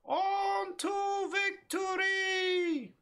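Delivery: very slow, high battle shout; slow rallying cry. Pretend. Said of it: "Very slowly, apparently!".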